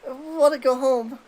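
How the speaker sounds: ordinary talk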